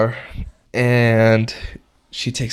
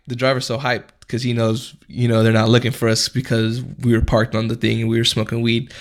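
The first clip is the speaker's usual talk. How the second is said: usual speaking voice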